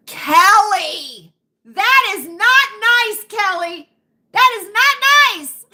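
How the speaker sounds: loud, high, drawn-out telling-off; playfully scandalised, stretching each word; mock scolding. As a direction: scold a friend in mock outrage. Mock-scolding a chat commenter.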